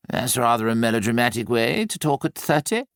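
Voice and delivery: lightly voiced Nicholas, mildly teasing; a hint of dry amusement from Nicholas; Nicholas's voice. A narrator's reading.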